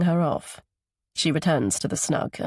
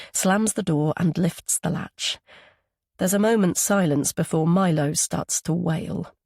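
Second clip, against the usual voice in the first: plain reading voice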